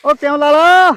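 loud, high mocking taunt; dramatic loud aunty. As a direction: crow over him loudly. Loudly mocking Lala. (ਉੱਚੀ ਆਵਾਜ਼ ਵਿੱਚ ਤਾਅਨਾ)

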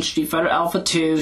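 ordinary talk, nothing marked

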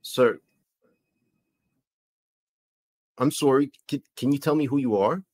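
put-on light comic Canadian accent; puzzled host with a Canadian accent. Host asks who hijacked the feed, light Canadian accent. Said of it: "that was a good Canadian accent".

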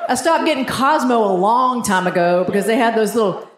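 ordinary talk

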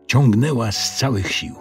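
plain reading voice

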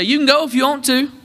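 high-pitched Southern drawl. Shown as a demonstration. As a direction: demonstrate a good Southern drawl for the crowd. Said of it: "say it with that good Southern drawl".

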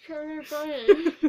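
laughing through a stuffed mouth; cheeks stuffed full. Real, not acted. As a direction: giggle out the words, mouth crammed. Chubby bunny marshmallow challenge.

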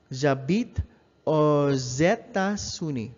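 J'habite aux États-Unis with aux linked as "oz" before États; slow the liaison: aux États, "o-zé-ta-zuni". Shown as a demonstration. Teacher showing the z liaison to a French class, slow and careful. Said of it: "you pronounce the X as an S sound".

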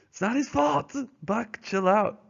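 high, pleading "chill out"; teacher voicing a defender of Sol-leks, high scolding voice. Voices someone scolding Buck.

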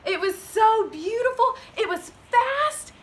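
high, admiring narration; rising storybook wonder; awed excitement. A narrator's reading. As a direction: marvel at the horse. Marvels at the horse.

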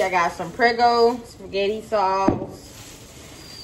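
ordinary talk, nothing marked

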